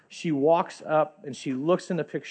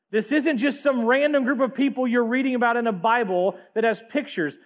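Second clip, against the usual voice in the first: higher, louder impassioned preaching; preaching at full force; 7 semitones higher, 4 dB louder than usual. Acted. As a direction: drive it home to the congregation. Preaching climax.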